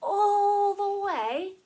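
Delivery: loud, high, long-held "all"; hugely drawn out. Shown as a demonstration. Teacher picturing a string reaching loved ones, hugely drawn out and loud. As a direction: stretch "all" across the whole distance. String stretching from heart to others.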